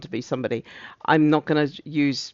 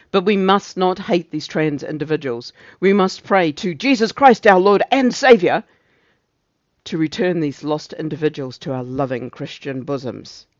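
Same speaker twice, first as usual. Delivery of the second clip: preachy gender officer; 4 dB louder than usual. Acted. Officer urging prayer for lost souls, preachy and swelling. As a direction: declaim piously, swelling on the Saviour. Acting Officer Clarence's sermon in the script.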